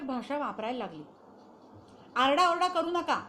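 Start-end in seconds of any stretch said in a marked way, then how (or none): 2.2-3.2 loud, high scolding yell; retold yell. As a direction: snap it out loud, high-pitched. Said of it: "ती ओरडायची". (मोठ्याने ओरडून दटावणे)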